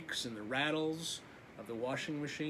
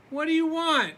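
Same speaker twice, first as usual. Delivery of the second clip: high, loud startled question; a scared child asking; frightened boy; 12 semitones higher, 10 dB louder than usual. Acted. Laszlo asking the dark in the night, high and frightened. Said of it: "asked Laszlo".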